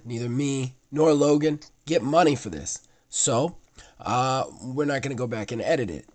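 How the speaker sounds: ordinary talk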